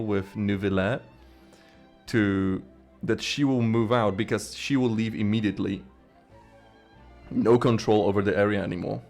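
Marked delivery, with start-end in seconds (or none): none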